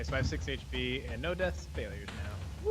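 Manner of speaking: normal talking voice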